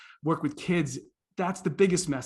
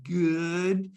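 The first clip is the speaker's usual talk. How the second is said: drawn-out group reply; class answering together. Retold or imitated. First graders answering their visitor, imitated drawn-out chorus. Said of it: "And they all went".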